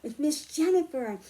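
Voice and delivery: put-on slow, lilting storybook read for kids; reading to children. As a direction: read it like story time for little ones. Reads picture book to children.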